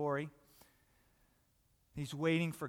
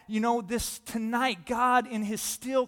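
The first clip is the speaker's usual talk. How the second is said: ordinary delivery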